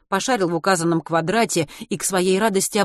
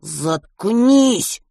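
desperate hiss; 4 semitones higher, 5 dB louder than usual. A narrator's reading. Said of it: "в отчаянии шипел Матвей".

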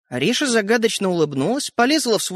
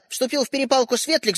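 usual speaking voice

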